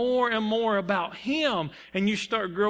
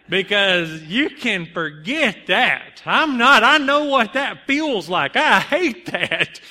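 loud, emphatic protest; acted-out adamant outburst; vehement refusal; 7 dB louder, slower than usual. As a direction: refuse it flat out, hitting each phrase hard. Imagined refusal to preach, emphatic.

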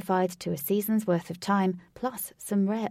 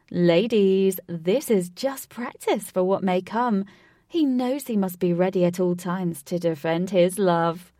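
eccentric showman voice; 6 dB louder than usual. Acted. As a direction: ham it up like a game show host. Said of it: "in his eccentric voice".

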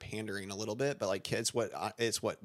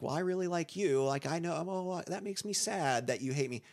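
higher, sugary pleading teacher voice, lightly mocked; mock sweet reply; 7 semitones higher than usual. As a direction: parody the adult who makes it about themselves. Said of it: "a lot of people land like".

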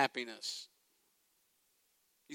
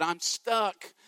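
crying out for help; 4 semitones higher, 5 dB louder than usual. Retold or imitated. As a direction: call up from the pit, growing more desperate. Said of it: "crying out from the bottom of the pit".

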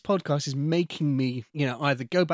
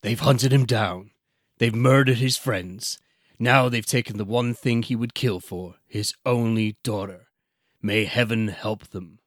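slow, loud, dramatic trailer narrator; movie trailer voice; 3 semitones lower, 4 dB louder than usual. Acted. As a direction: boom it out like a blockbuster trailer. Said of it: "like, in the trailer voice".

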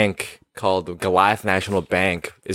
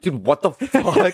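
laughing disbelief; 6 semitones higher, slower than usual. Real, not acted. Shocked by the dad's story.

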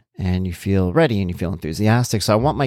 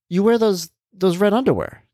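higher-pitched voice, lightly imitating a child; imitated daughter; 10 semitones higher than usual. Daughter telling dad what he wears, imitated higher voice. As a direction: retell her accusation in a raised pitch.

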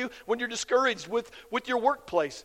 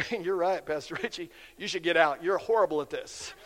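mock agreement; 6 semitones lower, 3 dB quieter than usual. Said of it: "people in my life that go".

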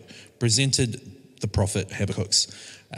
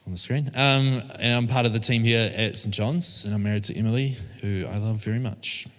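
normal talking voice

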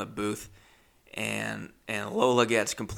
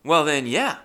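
higher, louder, suddenly eager; mock-thrilled, higher and louder; eager yes; 6 semitones higher, 5 dB louder than usual. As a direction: jump at the offer, overexcited. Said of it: "And I said".

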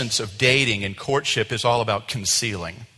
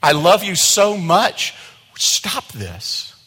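pleading; 7 semitones higher, 9 dB louder, slower than usual. Retold or imitated. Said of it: "He was just crying".

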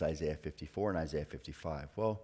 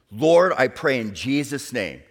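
fervent prayer; 8 semitones higher, 14 dB louder than usual. Retold or imitated. Praying for someone to start a chapel, fervent.

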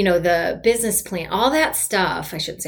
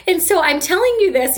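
higher and louder, bursting with pride; proud and excited; 9 semitones higher, 4 dB louder than usual. Real, not acted. Telling listeners about her wins, proudly excited. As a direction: let the pride lift the voice. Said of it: "you can probably hear the inflection in my voice".

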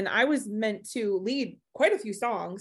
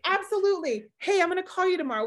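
high, quick retold reply; breezy retelling; 9 semitones higher, faster than usual. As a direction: breezily brush it off, pitched high. Retold breezy phone promise.

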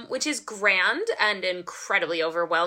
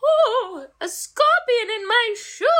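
high, loud mock fright; mock scared; 12 semitones higher, 3 dB louder, slower than usual. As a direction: squeal it like a scorpion scare. Acting out imagined scorpion scare.

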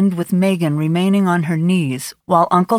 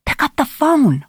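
higher, pleading repeat; urgent pleading thought; 8 semitones higher than usual. A narrator's reading. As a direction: beg it more urgently. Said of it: "Carly thought".

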